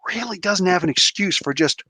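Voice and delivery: ordinary talk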